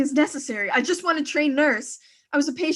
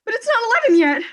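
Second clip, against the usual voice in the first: alarmed; 5 semitones higher, 5 dB louder than usual. Acted. Shocked by the late hour.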